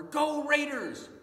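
high, loud fan yell; sports cheer. Acted. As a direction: cheer for your team from the stands. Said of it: "We cheer for that a lot".

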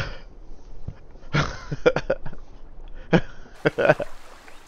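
high, voiceless fit of laughter; helpless laughing in long bursts; real laughter. Real, not acted. Bursts out laughing after accent attempts.

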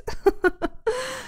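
short high amused giggle; light laugh. Acted. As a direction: laugh gently at the small mishap. Amused that it's just a plate.

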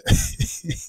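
quick chuckle; amused laugh. Real, not acted. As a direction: break into a brief laugh. Host amused by artist's energy.

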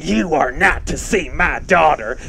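mock stern mother's yell; imitating mother's yelling. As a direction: forbid it like an outraged mother. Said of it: "there was your mother yelling".

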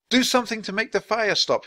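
high, urgent plea; shouted. A narrator's reading. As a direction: shout the command to the wand. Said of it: "he shouted".

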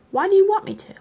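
suspicious and annoyed. A narrator's reading. Bilbo turns on Gandalf over the ring, sharp and suspicious. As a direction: shoot the question up in sharp suspicion. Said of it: "It was sharp with suspicion and annoyance".

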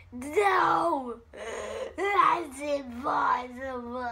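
drawn-out anguished movie shout; Luke yelling. Acted. Vlogger performs Luke's Star Wars line, shouted and drawn out. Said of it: "Now it's time for Luke".

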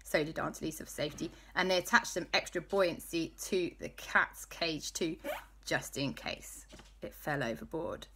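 ordinary delivery, nothing marked

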